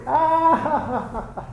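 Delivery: high, mock burst of laughter; acted laughter. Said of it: "he starts laughing".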